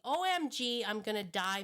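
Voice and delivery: hammed-up oh-my-gosh panic; mock panic. Shown as a demonstration. Trainer naming the fear moment, mock panic. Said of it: "what I like to call this cycle".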